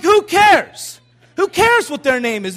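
high, loud burst of protest; exasperated shout. Retold or imitated. Retold argument over baby name.

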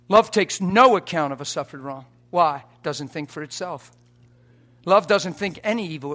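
loud, rising preacher's proclamation; full-force preaching. Acted. Preacher driving home love to congregation, shouting.

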